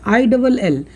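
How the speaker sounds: ordinary talk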